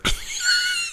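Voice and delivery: high squealing laugh burst; shrieking laugh. Real, not acted. Bursts out at the joke.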